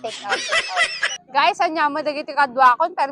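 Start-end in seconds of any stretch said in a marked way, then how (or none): 0.4-0.9 giggling through the words; laughing. Real, not acted. Laughing at a bag joke with friends.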